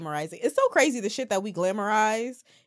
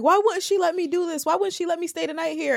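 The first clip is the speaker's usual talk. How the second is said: higher-pitched mock grievance; indignant teen complaint; 9 semitones higher than usual. Teen grumbling about a strict mom, mock indignant. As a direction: complain like a thwarted teenager.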